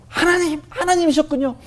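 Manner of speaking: retelling a prayer said while weeping; retold tearful prayer. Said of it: "크게 막 울기 시작하면서". (훨씬 높은 목소리로 울먹이듯 외치는 기도)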